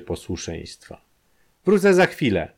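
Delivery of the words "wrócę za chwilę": high, loud sudden cry as she jumps up; shouted. A narrator's reading. Wanda, breaking under the strain, shouts to the room. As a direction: fling the words out and bolt. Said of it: "krzyknęła".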